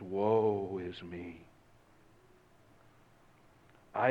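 hushed repentant prophet; contrite lament. Retold or imitated. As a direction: speak as a broken man before God. Isaiah confesses before God.

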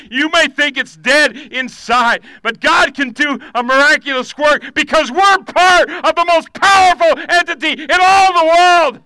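preaching building to shout. Acted. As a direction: build the sermon up to a full cry.

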